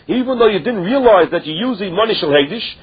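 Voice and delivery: ordinary talk, nothing marked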